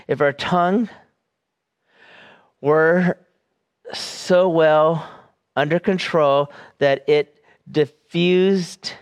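slow, deliberate quotation with long pauses; very slow reading. Real, not acted. Preacher reading a commentator's words to the congregation, very slowly. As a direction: read it slowly so it sinks in. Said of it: "read that very slow so it sinks in".

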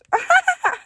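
sudden high squealing laugh; shrieking laugh. Real, not acted. Tickled by his one-word praise, a high squealing laugh. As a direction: burst out in a squeal of delight. Reacting to his reply "Gold.

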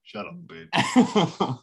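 "Shut up, bitch" slow and loud; wrestler trash talk. Retold or imitated. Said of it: "There's a clip, uh, from wrestling".

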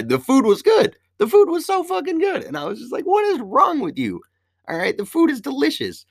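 very high-pitched, indignant protest; exasperated insistence. Retold or imitated. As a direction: insist with the voice soaring in disbelief. Exasperated defence of the food.

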